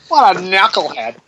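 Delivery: bad voice-acting mimicry; imitated game taunt. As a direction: echo the cheesy victory line. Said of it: "he says it just like that too".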